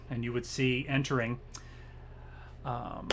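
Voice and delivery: ordinary talk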